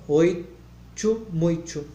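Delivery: "oito" and "muito" with a tch sound, "oitchu", "muitchu"; slow "oito", t turned to tch; palatalized t. Shown as a demonstration. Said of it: "Não". ("oito" e "muito" com t chiado, oitchu, muitchu)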